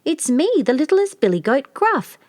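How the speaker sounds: a tiny billy goat piping up; little goat's voice. A narrator's reading. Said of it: "in his little bit of voice".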